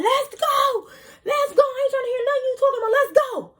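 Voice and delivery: very high, loud, fast scream. Retold or imitated. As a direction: scream at her to leave now, near tears. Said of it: "I'm yelling at her".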